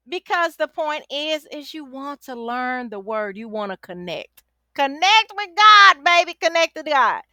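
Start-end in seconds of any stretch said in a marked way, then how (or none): 4.7-7.2 loud urging. Real, not acted. Coach urging viewers toward God, loud. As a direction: call it out louder and higher.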